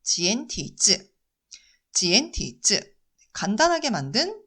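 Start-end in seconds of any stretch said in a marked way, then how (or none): 0.0-1.1 slow, careful 지엔티즈 in Mandarin sounds; 지엔티즈 said slowly as jiantizi; Chinese pronunciation. Shown as a demonstration. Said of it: "중국어로는". 1.9-2.9 slow, careful 지엔티즈 repeated; 지엔티즈 said slowly as jiantizi again; Chinese pronunciation. Shown as a demonstration. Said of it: "중국어로는".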